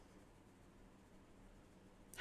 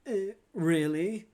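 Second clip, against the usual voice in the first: surprised; 9 semitones higher, 7 dB louder, slower than usual. Real, not acted. Chat calls her a voice claim.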